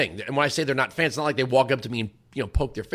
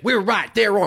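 higher mock host catchphrase; imitating the host; 6 semitones higher, 4 dB louder than usual. [name] mimicking the host's catchphrase, mock voice. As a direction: parrot the host's slogan back at him. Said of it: "he starts imitating me".

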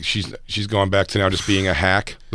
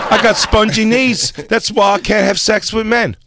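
mock Australian accent; 11 semitones higher than usual. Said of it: "With that shitty accent?".

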